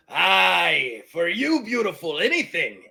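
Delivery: drawn-out pirate flattery; obliging pirate. Acted. Pirate yields the money to T-Rex, flattering her. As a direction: give in with a roguish flourish.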